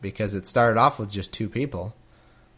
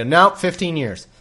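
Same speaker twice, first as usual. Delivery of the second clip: louder, higher, abrupt counteroffer; mock flat refusal; 6 semitones higher, 6 dB louder than usual. Laban rejecting Jacob's lowball offer, mock-emphatic and louder. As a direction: slap down the offer like a stubborn haggler. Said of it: "he's not gonna instantly go".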